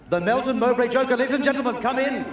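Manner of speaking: grand introduction. Real, not acted. Presenter welcoming the Joker to the crowd, showman call. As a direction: roll out the welcome like a ringmaster.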